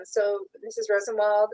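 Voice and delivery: ordinary talk, nothing marked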